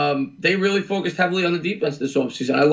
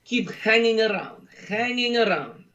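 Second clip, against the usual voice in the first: slow, higher mock Russian drawl; mock Russian poker villain; Teddy KGB Russian accent; 5 semitones higher, slower than usual. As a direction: put on a hammy Russian card shark. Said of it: "do it again, the, uh, Teddy K G B".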